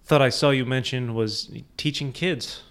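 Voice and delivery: ordinary talk, nothing marked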